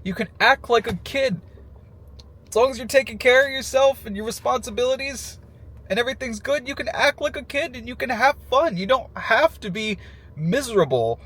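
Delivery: ordinary delivery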